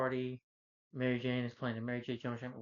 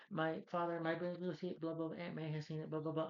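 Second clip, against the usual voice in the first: mock her like a self-absorbed girlfriend; mocking Mary Jane; 4 semitones higher than usual. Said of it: "she tells him".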